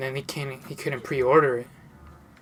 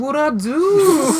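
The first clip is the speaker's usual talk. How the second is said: high, loud, drawn-out squeal; hammed-up high protest; mock protesting friend; 11 semitones higher, 7 dB louder, much slower than usual. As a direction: imitate a friend whining innocence at the top of the voice. Friend's indignant reply, acted.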